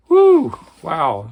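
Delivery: loud exhale of awe; whoo. Real, not acted. Reviewer reacting to the intense passage, awed exhale. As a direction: blow out, impressed. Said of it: "reacting to the passage".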